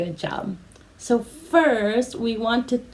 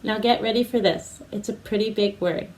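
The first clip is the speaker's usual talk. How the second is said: ordinary talk, nothing marked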